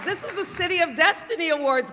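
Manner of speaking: high, excited proclamation to the room; rallying the crowd. Real, not acted. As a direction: raise your pitch and pump up the audience. Rallying an audience that sounded unexcited.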